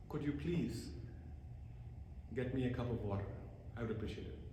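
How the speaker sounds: soft, gentle "Could you please"; calm, quiet asking for water; polite quiet request. Shown as a demonstration. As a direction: ask softly and kindly. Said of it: "The words are exactly the same".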